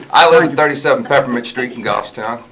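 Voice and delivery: speaking clearly. Real, not acted. Resident restating his address for the minute taker, speaking clearly. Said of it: "Everybody has to speak clearly".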